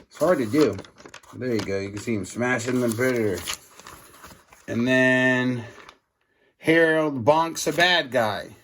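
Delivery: ordinary delivery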